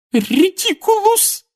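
high, slow sobbing cry of the spell; mother sobbing out a spell, high and slow; sobbed spell. A narrator's reading. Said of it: "прорыдала миссис Уизли".

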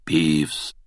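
rasped, hushed name; croaky ghostly whisper; hoarse whisper. A narrator's reading. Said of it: "хриплым шёпотом".

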